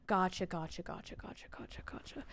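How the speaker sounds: repeated word sinking into a whisper; fading to whisper. Real, not acted. Acknowledging chat's correction, fading into whispered repetition. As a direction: start low, fade away to a voiceless whisper.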